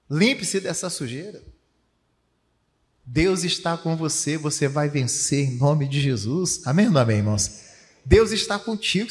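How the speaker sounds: rousing sermon delivery with punched key words; loud bursts of pulpit encouragement; fervent preaching. Acted. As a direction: exhort the congregation, stretching the big promises. Preaches at full force.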